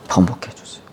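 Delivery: whispered begging; whispered plea. Acted. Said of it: "통곡하면서 기도한 거예요". (속삭이듯 애원하는 목소리)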